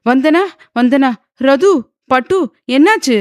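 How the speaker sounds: high, loud, urgent calling; panicked. A narrator's reading. Father on the phone hearing daughter scream, panicked. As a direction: call out in growing alarm. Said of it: "போனில் பதட்டமாக கேட்டார்".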